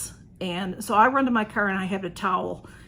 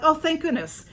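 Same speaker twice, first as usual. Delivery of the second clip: relieved; 7 semitones higher than usual. Retold or imitated. Relief on hearing help.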